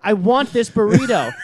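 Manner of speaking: imitated customer, high and loud; talking down to an idiot. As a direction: insist as if the listener is stupid. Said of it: "And he said it like".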